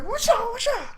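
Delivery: high-pitched "wusha" swishes; stabbing noises. Acted. Reader acts out stabbing people left and right, high swishing noises. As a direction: make squeaky slashing sound effects.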